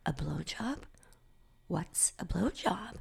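slow, hushed question; puzzled whisper. Retold or imitated. Girl asks friend about the gossip, puzzled whisper. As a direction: whisper it, clueless and curious.

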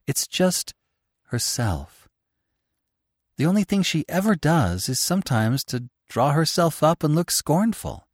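a working mother's anxious, earnest confession; earnest mother pleading. Acted. Mother confides in the author, pleading and earnest. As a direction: pour out her worry about the children.

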